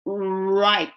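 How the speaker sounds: "right" with a drawn-in "う" onset; English R. Shown as a demonstration. Teacher repeating the R example, rounded onset. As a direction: purse lips, then glide into "right". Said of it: "Rの音になります".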